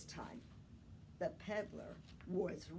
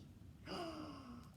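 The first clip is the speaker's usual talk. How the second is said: surprised gasp; 6 semitones higher, 10 dB quieter than usual. A narrator's reading. Peddler spots monkeys in tree.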